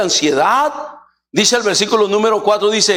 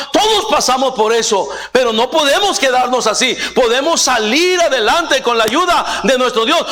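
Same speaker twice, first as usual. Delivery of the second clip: raised, driving sermon voice, pitch higher; fiery preaching; 7 semitones higher than usual. Acted. Preacher at full force. (predicación enérgica, tono más agudo)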